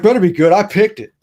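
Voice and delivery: imitating a prickly clerk defending his radio; touchy.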